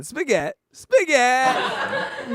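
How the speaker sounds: excited shout. Real, not acted. Host keeps shouting the word.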